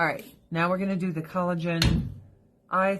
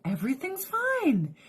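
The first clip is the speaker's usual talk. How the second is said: much higher, mock-innocent cat; scheming cat voice; 11 semitones higher than usual. Owner voicing cats learning the rules, high and sly. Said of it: "They'll be like".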